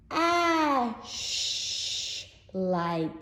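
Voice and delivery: "ash light" split and stretched on the sh; sound-out. Shown as a demonstration. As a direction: break the word to show the middle sound. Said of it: "listen to that again".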